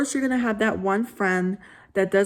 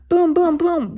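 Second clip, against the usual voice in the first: knocking sound; 8 semitones higher, 6 dB louder than usual. Acted. Knocking on the door.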